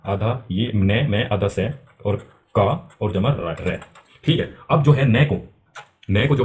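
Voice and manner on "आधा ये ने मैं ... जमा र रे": slow syllable-by-syllable split; teacher splitting syllables one by one; syllables broken slowly. Shown as a demonstration. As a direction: break the word apart slowly for the class. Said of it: "तो नमस्कार कैसे बनता है?".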